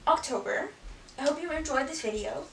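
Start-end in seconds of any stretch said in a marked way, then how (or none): none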